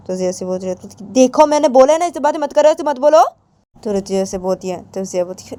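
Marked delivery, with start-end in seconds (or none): 1.1-3.2 high, loud, insistent warning; angry scolding. Acted. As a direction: forbid it with voice raised. Acted scolding, forbidding such talk. (गुस्से में ज़ोर से मना करते हुए)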